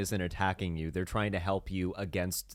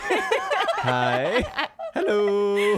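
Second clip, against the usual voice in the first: high, loud laughing mock sweet hello; hammed-up sweet greeting; 12 semitones higher, 7 dB louder, much slower than usual. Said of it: "sweet, soft voice".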